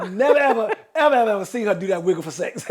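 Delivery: can barely get it out for laughing; real laughter while telling it. Real, not acted. Laughing at his own story.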